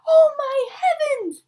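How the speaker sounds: high, loud, slow gasp of horror; mother shrieks in alarm; a panicked mother's cry; shocked mother cry. Acted. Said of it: "she cried".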